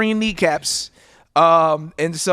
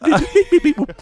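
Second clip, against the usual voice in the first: alien beeps; 8 semitones higher than usual. Pretend.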